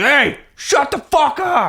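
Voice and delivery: high, drawn-out yell; imitated outraged bellow, stretched out; mock angry shout. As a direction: yell back at a heckler. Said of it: "shout his name, and he's like".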